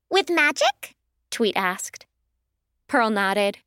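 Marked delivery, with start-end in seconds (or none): 0.1-0.9 high voice, rising question; a small bird asking warily; wary little firebird. Acted. Firebird asks doubtfully.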